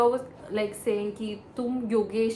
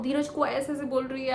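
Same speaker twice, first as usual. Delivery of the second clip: usual speaking voice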